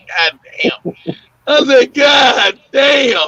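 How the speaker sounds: shocked curse through laughter; god damn, half laughing. Retold or imitated. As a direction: laugh it out in disbelief. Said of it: "I said".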